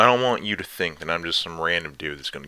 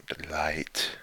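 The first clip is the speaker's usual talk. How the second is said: whisper; 5 dB quieter than usual. Acted. Whispered endearment.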